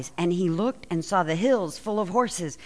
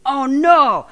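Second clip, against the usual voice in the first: mock alarm; 4 semitones higher, 6 dB louder than usual. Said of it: "just like ours would be".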